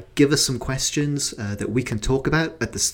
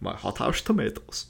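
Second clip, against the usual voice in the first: softer, badly faked Russian accent; 6 dB quieter than usual. Host repeats the smuggler's excuse, mock Russian accent. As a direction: quietly mimic a Russian with slushy s sounds. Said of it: "my Russian accent".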